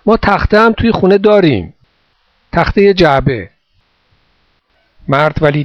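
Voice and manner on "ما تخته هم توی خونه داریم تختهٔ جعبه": higher, raised voice after someone leaving; calling out. A narrator's reading. Boy offering box wood to the departing man, calling out. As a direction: keep calling so it carries. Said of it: "و ادامه داد".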